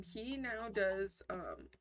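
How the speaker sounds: ordinary delivery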